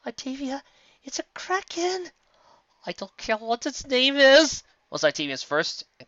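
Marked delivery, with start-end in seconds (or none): 0.1-2.1 high, slow panicked cry; high and frightened. A narrator's reading. Panicked warning. 2.8-4.6 high, loud, slow; loud frightened retort; panicked snap. A narrator's reading. Octavia panicking.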